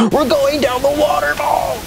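put-on high, slow, wide-eyed kid voice; playful sledding squeal. Playing a kid sledding in the bathtub, high slow squeal. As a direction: ride the slope like a thrilled child. Pretend ride down waterfall.

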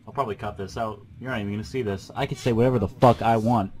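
ordinary delivery

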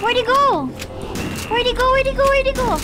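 high, loud, frantic searching; yelping as the pet vanishes; too excited. Real, not acted. Streamer panics watching pet Hans dive into water, excited yelling. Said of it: "I got too excited".

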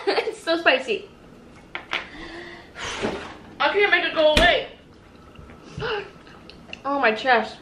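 talking through a burning mouth; pained, spice-burned voice. Real, not acted. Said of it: "my mouth is burning".

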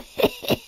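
quiet villainous snicker; scheming chuckle. Acted. Brachus relishing the plan, a sly low-volume chuckle. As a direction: snicker softly over the plot.

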